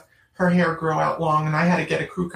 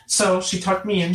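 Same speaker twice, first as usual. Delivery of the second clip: ordinary delivery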